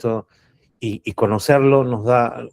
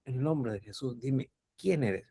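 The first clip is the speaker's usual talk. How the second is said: quiet command; 13 dB quieter than usual. Shown as a demonstration. Preacher demonstrating a calm exorcism, very soft. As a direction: say it almost under the breath. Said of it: "mi tono de voz es irrelevante".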